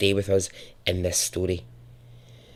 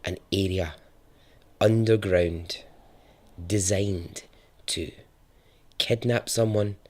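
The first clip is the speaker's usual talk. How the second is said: hushed true-crime narration; 3 semitones lower, 6 dB quieter than usual. Acted. Narrator to listeners, revealing the hidden bunker, hushed and ominous. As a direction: lean in and let the dread sink.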